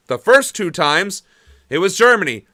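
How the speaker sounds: ordinary talk, nothing marked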